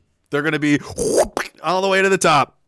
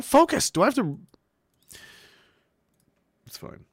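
ordinary talk

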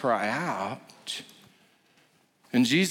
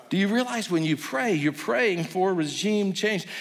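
normal talking voice

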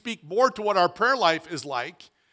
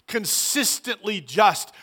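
slow, emphatic answer; 2 semitones higher, 3 dB louder, slower than usual. Shown as a demonstration. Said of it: "and what?".

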